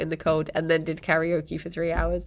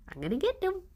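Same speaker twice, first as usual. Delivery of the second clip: playful pet talk; 10 semitones higher, 6 dB quieter, faster than usual. Real, not acted. Playfully threatening the cat.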